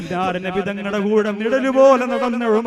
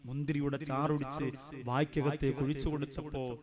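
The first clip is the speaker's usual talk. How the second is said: much lower and softer, close hushed narration; hushed storytelling; 8 semitones lower, 12 dB quieter, faster than usual. Acted. Preacher telling the crowd a sad tale, lower and hushed. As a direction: drop the voice low and soft to draw them in.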